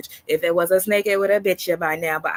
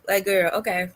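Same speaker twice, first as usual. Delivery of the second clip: normal talking voice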